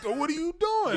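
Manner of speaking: high, loud retold yelp; comic pained complaint; pained protest. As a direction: cry out as if being squeezed. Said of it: "I'm in pain".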